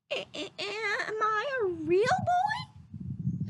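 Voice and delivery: put-on high, slow, halting little boy voice; stammering wonder. A narrator's reading. Pinocchio coming alive, high stammering boy voice. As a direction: stammer out your first words in awe. Puppet's first words, stammer.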